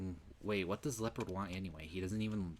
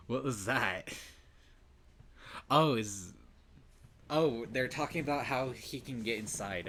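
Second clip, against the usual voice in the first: louder, higher amused disbelief; real loud reaction, half laughing; loud excited; 5 semitones higher, 9 dB louder than usual. Real, not acted. Reacting loudly to game.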